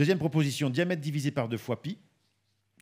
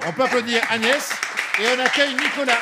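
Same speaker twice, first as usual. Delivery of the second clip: showman announcement; 11 semitones higher, 9 dB louder, faster than usual. Acted. Annonce le candidat suivant.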